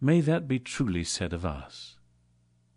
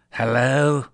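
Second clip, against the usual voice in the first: growl; 5 semitones higher, 7 dB louder than usual. Acted. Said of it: "growled Scrooge in his accustomed voice".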